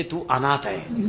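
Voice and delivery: slow, low, softened punchline; mock dismissive wife. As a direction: drop low and draw out the verdict. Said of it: "शेवटी म्हणते".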